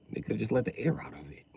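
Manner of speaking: unvoiced whispered aside. Real, not acted. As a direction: mutter the joke under your breath. Aside under breath.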